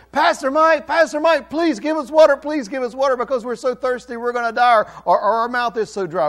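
high, loud begging as if dying of thirst; desperate plea. Acted. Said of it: "you would start saying".

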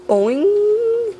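squeaky high boing sound effect; high cartoon boing. Boy voicing his game character's jump, a high boing. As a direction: sing out the bounce high.

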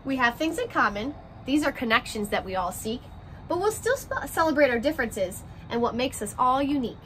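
gentle picture-book read-aloud, rhymes bouncing along; reading to children. A narrator's reading. Teacher reading a class picture book to her first graders, story-time voice.

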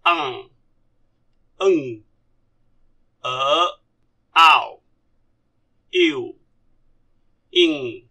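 each vowel alone, mostly falling in pitch; pronunciation demo. Shown as a demonstration. Teacher models vowels for learners, isolated falling sounds. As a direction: recite the vowels one by one, long pauses between. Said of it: "we pronounce the vowel".

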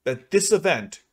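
normal talking voice

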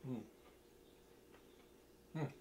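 ordinary talk

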